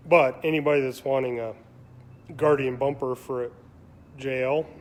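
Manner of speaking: talking through hiccups; hiccupping between words. Real, not acted. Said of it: "excuse me, I got the hiccups".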